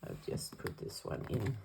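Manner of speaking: normal talking voice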